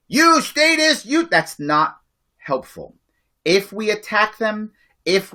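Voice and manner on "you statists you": higher, louder sneering taunt; mock accusing jab. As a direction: jab at them with a mocking insult. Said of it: "It's not helpful to say".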